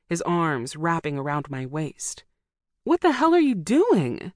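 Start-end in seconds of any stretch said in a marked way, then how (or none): 2.8-4.3 loud, high protest; angry objection. A narrator's reading. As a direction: shout it while struggling to break free. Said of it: "I objected".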